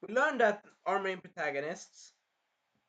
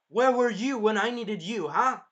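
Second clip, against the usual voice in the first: high, loud reproach; imitating a bitter hero; angry accusation; 7 semitones higher, 9 dB louder than usual. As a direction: throw it in his face. Shield guy confronts.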